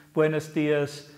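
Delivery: ordinary talk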